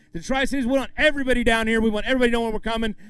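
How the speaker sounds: normal talking voice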